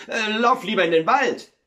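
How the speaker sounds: high, loud, nervous plea; anxious urging. Acted. Miller sends his son to the wood, anxiously urging.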